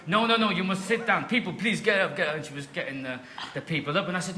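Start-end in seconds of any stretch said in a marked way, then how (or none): 0.1-2.4 bossy, raised-pitch woman in a mock Nigerian accent; hammed-up Nigerian accent, strong and insistent; terrible Nigerian accent. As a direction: order people about in a badly faked Nigerian accent. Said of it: "if it's a really terrible accent".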